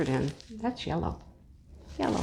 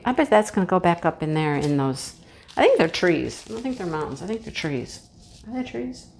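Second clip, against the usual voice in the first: normal talking voice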